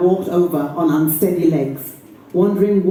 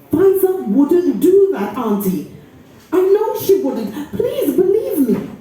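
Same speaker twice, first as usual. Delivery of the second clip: higher, begging voice; pleading; 7 semitones higher than usual. Acted. Niece begging her aunt to trust her friend. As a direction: beg her to believe you.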